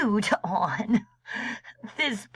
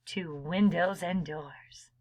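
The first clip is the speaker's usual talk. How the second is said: ordinary delivery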